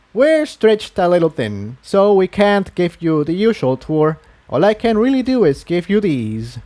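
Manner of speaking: kindly but harried townspony handing out gear; lightly voiced Quill Dipper. A narrator's reading.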